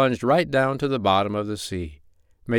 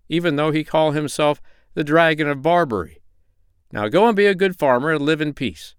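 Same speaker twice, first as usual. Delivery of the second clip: higher-pitched, lightly acted stern doctor; 6 semitones higher than usual. A narrator's reading. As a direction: lecture a pirate firmly in a raised pitch. Doctor warns the pirate.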